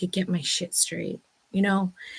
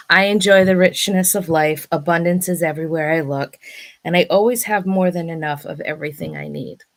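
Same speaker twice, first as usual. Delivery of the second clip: affirmation; 5 dB louder than usual. Acted. Reciting abundance affirmations.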